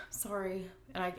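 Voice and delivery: ordinary talk, nothing marked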